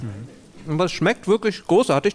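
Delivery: talking with mouth full; eating cake. Real, not acted. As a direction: speak through a mouthful of cake. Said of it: "Das Schlimme ist natürlich immer Essen und Reden".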